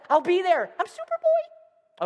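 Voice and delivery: high, slow, hammed-up hero declaration; boy superhero. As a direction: soar upward into a falsetto boast. Child imagining himself a superhero.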